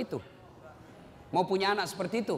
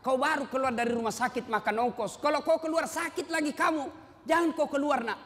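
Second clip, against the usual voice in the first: high-pitched imitation of a scolding mother; acted motherly telling-off; mother forbidding; 6 semitones higher than usual. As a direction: play a mother warning her son off, pitched up. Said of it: "Dilarang sama ibunya".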